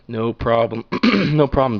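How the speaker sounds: loud, carefree no problem; breezy shrug-off. Retold or imitated. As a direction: shout it off like nothing happened. Brushing off the fire alarm.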